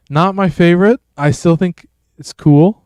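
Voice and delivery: ordinary delivery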